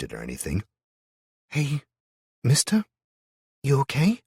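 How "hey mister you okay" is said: put-on high, shy child's voice calling out; a young girl timidly asking if he's hurt; shy little girl. A narrator's reading. Little girl shyly checks on the injured stranger. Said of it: "came a shy voice".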